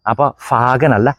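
ordinary talk, nothing marked

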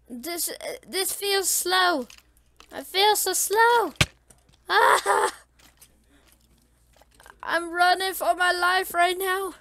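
high, loud, frantic complaint; panicked. Real, not acted. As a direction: cry out as you flee for your life. Fleeing mobs in game.

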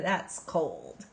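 ordinary delivery, nothing marked

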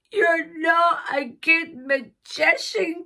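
slow, high, wailing complaint; mock crying. A narrator's reading. Sarah accusing the pony magician, mock crying. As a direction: whine it out through pretend tears. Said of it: "cried Sarah".

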